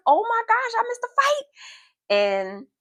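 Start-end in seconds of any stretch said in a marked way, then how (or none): 0.1-1.5 loud, squeaky comic lament; mock dismay. Child lamenting to her friend the missed fight, mock dismay. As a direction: cry out in mock horror, very high.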